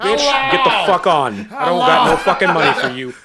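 high, loud yell through laughter; mock furious yell. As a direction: tell her off at full volume. Yelling at the begging woman.

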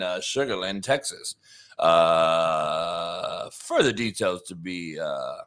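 deadpan parody of a breaking-news read; a hint of a TV anchor announcing a scandal; mock news anchor.

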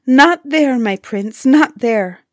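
high, urgent plea, mildly acted; storybook cry of alarm. A narrator's reading. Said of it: "Ilona cried out at this".

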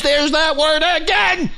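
high, irritated outburst; exasperated yell. Real, not acted. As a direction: yell it, fed up. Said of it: "I'm sorry I'm yelling".

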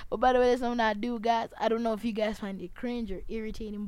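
usual speaking voice

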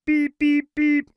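very high, loud beeping; truck horn beeps. A narrator's reading. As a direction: beep like a toy horn. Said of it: "said Little Blue".

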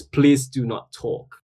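slow, prim announcement; mock sign voice. Guest reading out a subway sign, slow and prim. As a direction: read it like a stern notice. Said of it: "and it says".

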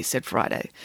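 ordinary delivery

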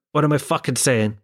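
ordinary talk, nothing marked

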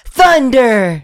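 high shouted attack call; high, drawn-out hero shout; Haley Joel Osment. Acted. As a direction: yell it like a game hero's battle cry. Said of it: "shouting it in the Haley Joel Osment voice".

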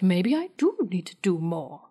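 put-on British accent for a retold line; lightly acted British librarian accent. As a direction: play Giles with a British accent. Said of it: "Is that your British accent?".

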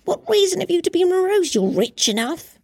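high, merry nephew voice; gaily. A narrator's reading. Nephew teases his uncle in a high merry voice. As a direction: tease him back brightly. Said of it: "returned the nephew gaily".

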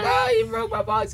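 high, wailing mock cry; crying whine. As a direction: whine it like a broken-hearted kid. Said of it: "You were crying, and then you were screaming".

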